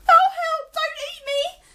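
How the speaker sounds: very high, loud squeal; terrified squeal. Acted. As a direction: squeal in terror. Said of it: "Piggy cried".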